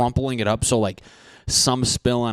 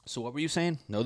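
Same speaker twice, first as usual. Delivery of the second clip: ordinary talk, nothing marked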